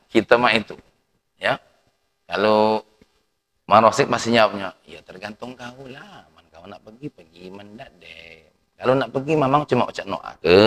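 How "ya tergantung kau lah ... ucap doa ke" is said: put-on much softer, barely audible old man's answer; a quiet shrugging answer; mumbled reply. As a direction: mumble it under your breath, unbothered.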